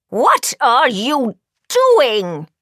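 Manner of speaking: loud, slow indignant shout. A narrator's reading. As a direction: shout it in outrage, stretching each word. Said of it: "she shouts".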